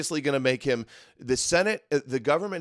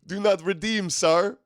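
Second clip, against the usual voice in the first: high-pitched, whining mock outrage; exaggerated high voice of mock protest; 8 semitones higher than usual. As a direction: wail it as a hammed-up offended zealot. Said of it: "He goes".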